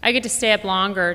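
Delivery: put-on a kid bragging she gets to stay up; mild big-sister brag; child boasting.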